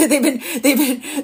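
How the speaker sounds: laughing. Real, not acted. Laughs while listing work.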